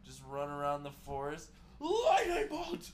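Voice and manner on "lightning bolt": high, slow, loud spell yell; spell shout. Acted. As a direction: cast it like a larper. Larping spell call.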